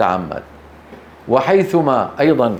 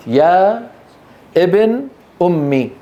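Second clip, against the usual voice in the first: يا ابن أمي split by clear pauses; three separate words; 2 semitones higher, slower than usual. Shown as a demonstration. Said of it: "هذه ثلاث كلمات".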